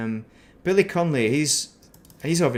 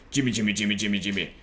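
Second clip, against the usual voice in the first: a lightly put-on comic Glasgow Scottish accent; sing-song Scottish "Jimmy" in a mild Glaswegian accent; Russ Abbott's Scottish accent; 2 semitones lower, faster than usual. Streamer imitating a comedian's Glaswegian catchphrase for viewers, mild Scottish accent. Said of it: "a Scottish accent".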